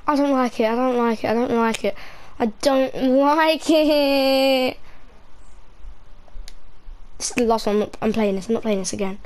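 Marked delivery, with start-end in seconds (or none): 2.4-4.8 whiny, very slow wail; long drawn-out whine. Real, not acted. Scared player refusing the game, drawn-out whine. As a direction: drag every word out in protest.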